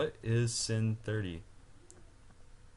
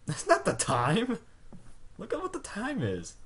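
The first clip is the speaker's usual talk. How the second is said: exasperated; 10 semitones higher than usual. Real, not acted. Outraged at the clock.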